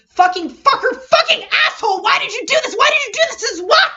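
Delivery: rising to a raw, near-hysterical yell; furious shout. Acted. Kaito screaming at the absent Kokichi, raw and furious. Kaito's breakdown over the notes.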